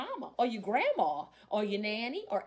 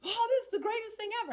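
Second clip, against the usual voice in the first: high, fast, gushing praise; fast, high-pitched gushing over the gumbo; gushing guest; 12 semitones higher, 4 dB louder, much faster than usual. Retold or imitated. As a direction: mimic an overexcited food fan. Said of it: "it is like".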